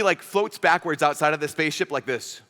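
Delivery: ordinary talk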